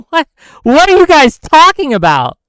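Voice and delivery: loud, high, incredulous yell; shouted disbelief, pitch way up; exasperated shout. Real, not acted. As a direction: blurt it out in disbelief at the chat. Exasperated outburst at the chat.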